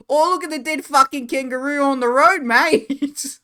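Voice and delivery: loud, high, hammed-up Aussie bloke; exaggerated Australian accent, loud and high; mock Australian accent. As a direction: point out roadkill like a cheery Australian. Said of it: "it'd be more realistic to be like".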